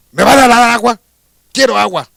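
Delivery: demanding. Acted. Hermit rehearsing a demand.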